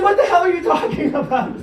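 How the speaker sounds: mock-incredulous outburst; baffled, laughing protest; shocked. Retold shocked reply.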